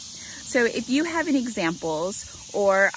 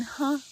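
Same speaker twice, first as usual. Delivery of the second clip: "Huh?" pitched up as a question; questioning inflection; 5 semitones higher than usual. Shown as a demonstration. Coach demonstrating question inflection to parents, questioning. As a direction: lift "Huh?" high, questioning. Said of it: "do you hear the inflection".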